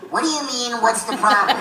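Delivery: high, squeezed indignant helium squeak; loud incredulous helium-accident voice. Acted. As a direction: protest as if full of helium. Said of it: "with the helium voice".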